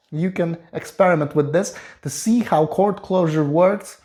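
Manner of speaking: normal talking voice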